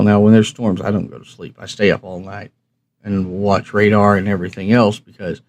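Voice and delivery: usual speaking voice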